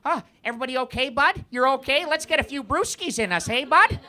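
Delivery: quick, high, chummy North American; North American accent. Acted. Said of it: "It's just North American".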